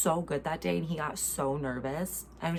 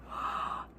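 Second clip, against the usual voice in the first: audible amazed gasp; sharp intake of breath; 6 dB quieter than usual. Real, not acted. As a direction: suck in a breath, astonished. Reacts to psychic dreams.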